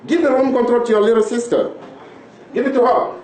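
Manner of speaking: scolding father. Acted. Father scolds quarrelling kids over the remote, firm and loud.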